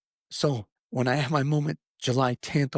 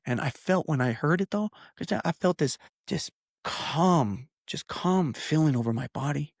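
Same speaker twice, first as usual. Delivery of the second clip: normal talking voice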